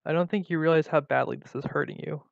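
ordinary delivery

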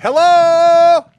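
loud, high call into the empty store; calling down a hallway. Real, not acted. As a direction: shout out to find someone in back.

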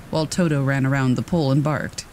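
ordinary delivery

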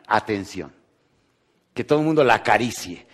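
usual speaking voice

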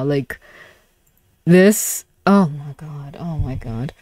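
ordinary delivery

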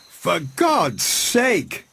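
high, very slow pleading cry. A narrator's reading. Montgomery crying out to stop Prendick. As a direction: beg him to stop. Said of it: "cried Montgomery".

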